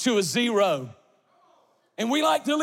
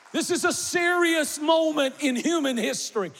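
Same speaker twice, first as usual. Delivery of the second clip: full-force preaching; 4 semitones higher than usual. Acted.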